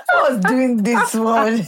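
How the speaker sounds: drawn out and laughed through; laughing mock disbelief. Guest retells her first reaction to a new friend, laughing.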